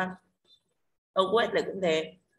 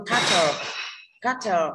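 "catcher" with CAT hit louder; stress on first syllable; 4 dB louder, much slower than usual. Shown as a demonstration. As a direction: punch the first syllable of "catcher", let the second drop. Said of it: "Cat nhận trọng âm". (nhấn âm tiết đầu "catcher")

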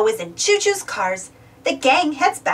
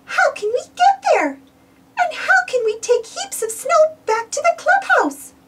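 higher, worried Minnie voice; Minnie's voice; 10 semitones higher than usual. A narrator's reading. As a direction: voice Minnie a good deal higher. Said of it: "Minnie says".

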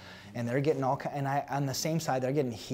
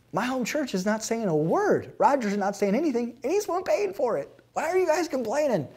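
pitched far up and louder, fed up; exasperated rant; 12 semitones higher, 5 dB louder than usual. Retold or imitated. Pastor venting at complaining colleagues, exasperated and loud. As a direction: fire off each line in exasperation.

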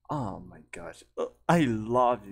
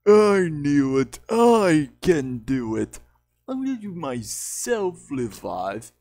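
goofy character; 3 semitones higher, 6 dB louder than usual. Shown as a demonstration. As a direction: drag out each word with force. Demonstrating a goofy kitty character.

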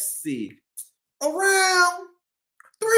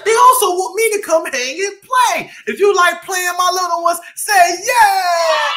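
calling out; 4 semitones higher, 7 dB louder than usual. Acted. Host invites kids to play along.

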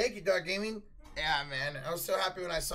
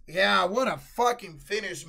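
ordinary talk

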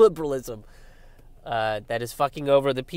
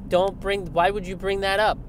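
mock liberal; 7 semitones higher than usual. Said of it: "That's always what I get from lib".

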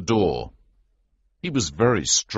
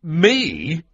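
high, louder startled question; startled cry; 11 semitones higher, 5 dB louder than usual. A narrator's reading. Hero asked to be prince for a day, startled cry. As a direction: blurt it out in disbelief, pitched up. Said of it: "I cried".

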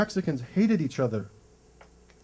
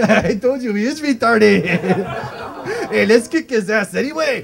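loud, cackling taunt; laughing bullies; 4 semitones higher, 7 dB louder than usual. Retold or imitated. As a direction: crack up while jeering.

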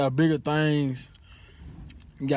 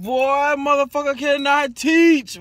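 higher and louder, fed-up outburst; exasperated rant; 9 semitones higher, 5 dB louder than usual. Real, not acted. Student venting about his professor, exasperated and louder. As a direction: vent about a useless teacher.

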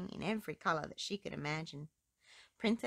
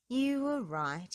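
higher, slow, gentle king; kindly king; 5 semitones higher, 5 dB louder, slower than usual. Acted. King apologising to his daughter, slow and gentle. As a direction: soften and admit it slowly. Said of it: "he said".

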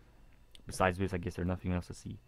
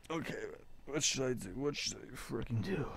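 anxious murmur; 5 semitones higher, 6 dB quieter than usual. Acted. Dithering alone in inner monologue.

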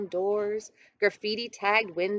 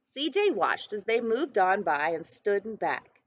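ordinary delivery, nothing marked

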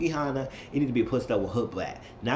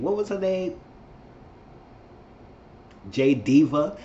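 normal talking voice

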